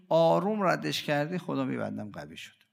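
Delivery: ordinary talk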